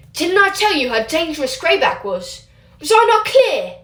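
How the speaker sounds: furious scolding. Acted. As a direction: hammer home the rebuke. Teacher scolds Remus.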